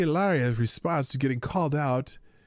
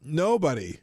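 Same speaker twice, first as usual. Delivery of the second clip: ordinary talk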